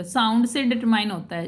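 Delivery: ordinary delivery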